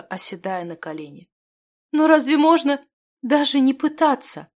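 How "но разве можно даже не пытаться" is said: high, distressed pleading; tearful protest. A narrator's reading. Weeping girl challenges the wizard's refusal, tearfully. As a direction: protest as if in tears. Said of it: "заливалась слезами девушка".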